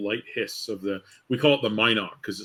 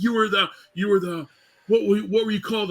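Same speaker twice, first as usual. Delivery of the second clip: put-on drunk fan; 10 semitones higher than usual. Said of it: "he's like".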